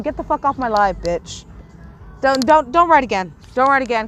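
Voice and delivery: loud, angry order. Real, not acted. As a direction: warn them off, hard and loud. Ordering a chatter to stop writing.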